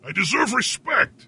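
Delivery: high, emphatic insistence; theatrical indignation; indignant demand. Real, not acted. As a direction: demand it with wounded pride. Acted indignation at being mocked.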